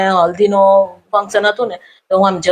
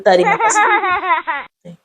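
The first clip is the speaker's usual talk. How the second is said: drawn-out, much higher greeting through laughter; laughing reply; 10 semitones higher, much slower than usual. Acted. She greets the scared staffer back, high, slow and laughing. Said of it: "પછી મેં સામે એમ કીધું".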